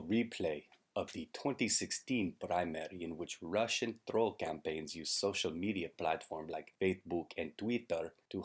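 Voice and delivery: broken-English Russian comedy character; hammed-up Russian accent throughout; mock Russian accent. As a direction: play a cartoon Russian comrade, deadpan.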